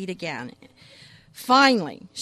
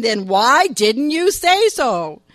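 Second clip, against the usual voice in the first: put-on slow, much louder exasperated yell at the boy; acted scream; 3 semitones higher, 10 dB louder, much slower than usual. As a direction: scream it slowly into his face, fed up. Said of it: "wanting to get in his face and scream".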